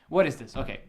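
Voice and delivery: normal talking voice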